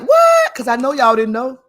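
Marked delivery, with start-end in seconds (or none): 0.0-0.5 high, loud shocked squeal; imitating a stunned audience's cry of disbelief; shocked what. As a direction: mimic the viewers' stunned reaction, much higher and louder. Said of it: "y'all gonna be like".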